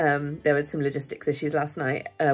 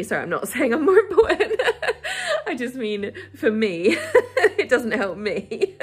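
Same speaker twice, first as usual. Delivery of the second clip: giggling, higher, words breaking into laughter; laughing through words; 5 semitones higher than usual. Real, not acted. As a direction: laugh it off while apologising. Laughing at her own slip.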